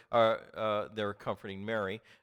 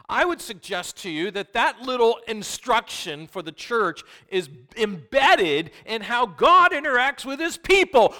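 preaching at full force; 12 semitones higher, 15 dB louder than usual. Acted.

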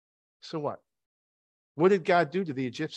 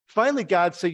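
ordinary talk

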